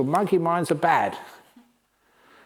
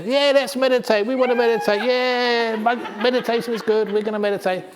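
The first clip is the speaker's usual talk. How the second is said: high, loud eager monkey chorus; excited monkeys; 9 semitones higher, 4 dB louder than usual. Acted. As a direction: cheer like a bunch of thrilled monkeys. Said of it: "So all the monkeys said".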